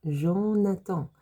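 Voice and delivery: slow Jonathan, zho-na-tan with a nasal end; French Jonathan. Shown as a demonstration. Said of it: "the way you pronounce the name Jonathan in French".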